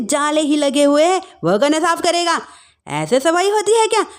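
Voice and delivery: high-pitched nagging complaint; exasperated scolding. Acted. Mother-in-law pointing out dirt to the daughter-in-law, exasperated scolding. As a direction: scold with pitch climbing as the faults pile up.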